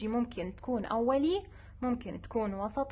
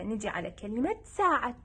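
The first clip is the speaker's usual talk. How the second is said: ordinary talk, nothing marked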